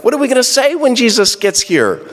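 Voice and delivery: high, playful voice of Mary and Martha plotting together; a pretend huddle before Jesus arrives; sisters scheming. Mary and Martha plan their words, playfully scheming. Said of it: "it's almost like they planned it".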